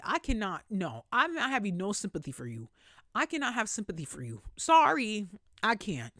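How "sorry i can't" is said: exasperated sorry. Real, not acted. Refusing sympathy.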